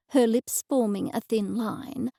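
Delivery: plain reading voice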